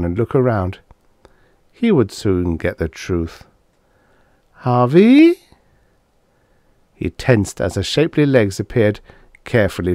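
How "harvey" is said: high, louder woman's call rising at the end; Dana calling. Acted. Dana calling for Harvey from the ship, high and anxious. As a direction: call out to him in the night.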